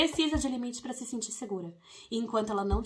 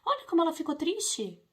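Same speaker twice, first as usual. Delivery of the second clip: permissive parent; 6 semitones higher than usual. Retold or imitated. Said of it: "o exemplo do permissivo".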